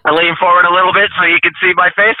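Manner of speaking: usual speaking voice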